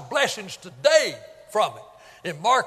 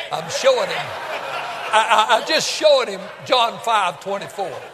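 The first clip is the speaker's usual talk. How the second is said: laughing through it, louder, near a shout; laughing retelling; 4 dB louder than usual. Retold or imitated. Preacher retelling the old man's answer, laughing loudly. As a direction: crack up while telling the punchline.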